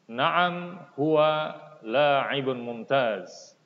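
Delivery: questions like "Hal huwa tilmidun jadid" rise at the end, answers fall; intoned model reading. Shown as a demonstration. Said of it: "harus ada intonasinya".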